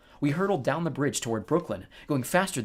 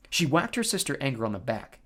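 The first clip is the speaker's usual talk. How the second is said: ordinary delivery, nothing marked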